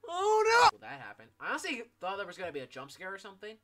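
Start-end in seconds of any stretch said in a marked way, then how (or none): 0.1-0.7 panicked yell. Real, not acted. Panic at event.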